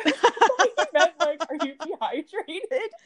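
high, laughing retelling. Real, not acted. Laughing as she tells her cohost the cop's question.

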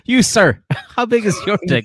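hammed-up shouted question; mock loud demand. Mock loud address to waiter.

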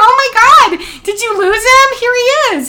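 very high, loud happy announcement; cheerful relief. Retold or imitated. Mother cheerfully returning the dog, very high and loud. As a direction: hand the dog over brightly. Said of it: "And I go".

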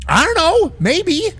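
high, dodging figure; high arbiter voice. Acted. Arbiter dodging the hero's question, high squeaky voice. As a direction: shrug it off in a squeaky voice.

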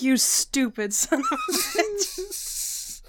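slow mock insult, laughing through it; Biden. Head-shaking Biden to a newscaster, slow laughing imitation. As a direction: drag out the insult like a disgusted politician, amused. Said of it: "he's shaking his head".

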